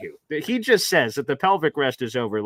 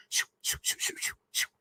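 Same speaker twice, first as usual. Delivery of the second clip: scribbling noise; 12 dB quieter than usual. Retold or imitated. Imitating fast note-taking.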